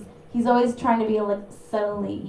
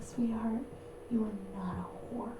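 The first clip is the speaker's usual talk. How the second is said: much softer mock comfort; hushed consoling; 10 dB quieter than usual. Consoling the ashamed boyfriend in bed, mock soft hush. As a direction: soothe him, then twist the knife quietly.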